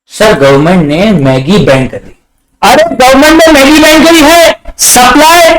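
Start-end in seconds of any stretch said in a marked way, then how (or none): 2.6-5.5 high-pitched, urgent outburst; acted alarm, pitched well up; mock excited student. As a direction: blurt it out like an over-eager student. Acting a student's eager reaction.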